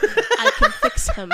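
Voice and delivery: high, loud, laughing boast; giggling joke offer to fix him. Pretend. Joking about the lisp.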